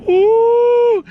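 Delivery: long, high, loud "ooh"; drawn-out falsetto ooh of delight. Real, not acted. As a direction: whoop an admiring high-pitched ooh. Raving about the lush lawn.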